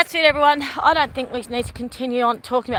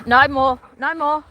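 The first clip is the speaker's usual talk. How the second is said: loud, firm "no more"; 4 semitones higher, 5 dB louder than usual. Real, not acted. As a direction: end the game firmly. Stopping the dog.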